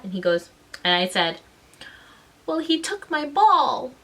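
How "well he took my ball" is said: high, slow tearful protest; mock crying. Little girl defending herself to the supervision aide, mock tearful. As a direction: imitate a child starting to cry on demand. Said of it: "I started to cry".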